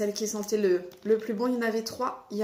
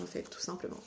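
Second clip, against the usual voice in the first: whispered aside; 4 semitones lower, 11 dB quieter, faster than usual. Real, not acted. Aside after the burnt bulb.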